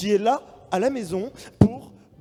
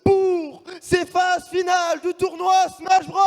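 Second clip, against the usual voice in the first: announcer hype; 9 semitones higher, 5 dB louder than usual. Acted. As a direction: open the show like a stage host. Caster opens the tournament stage.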